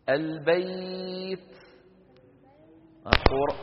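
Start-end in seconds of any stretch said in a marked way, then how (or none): 0.1-1.5 البيت stretched very slowly on its ي; drawn-out easy glide. Shown as a demonstration. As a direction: let البيت flow out slowly. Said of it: "شايفين السهولة عند نطق".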